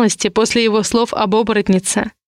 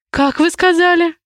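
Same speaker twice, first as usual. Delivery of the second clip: a startled, higher gasped question; gasping in shock; 7 semitones higher than usual. A narrator's reading. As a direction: caught off guard, breath catching. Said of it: "ахнула я от услышанного".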